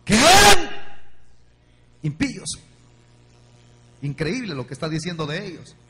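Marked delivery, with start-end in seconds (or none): 0.1-2.6 call and response. Acted. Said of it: "dígalo fuerte".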